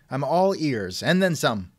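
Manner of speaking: ordinary delivery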